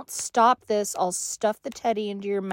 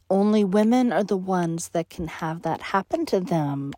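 normal talking voice

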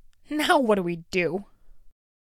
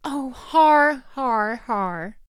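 sarcastic fake laugh; 2 semitones higher, 3 dB louder, much slower than usual. Bully mocks with a fake laugh.

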